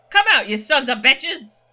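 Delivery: put-on loud, pained old man snapping at girl; loud gruff dad voice; gruff father Duke. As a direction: bark it out, hurting and protective. Duke shouts at creatures.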